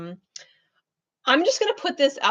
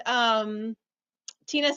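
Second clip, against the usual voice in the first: usual speaking voice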